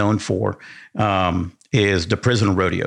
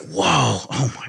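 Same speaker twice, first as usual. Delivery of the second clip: retold wide-eyed wonder, pitched high; imitated awe; 5 semitones higher, slower than usual. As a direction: act out an amazed, drawn-out whoa. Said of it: "his face is lit up".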